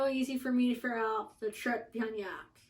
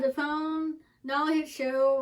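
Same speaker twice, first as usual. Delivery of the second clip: ordinary talk, nothing marked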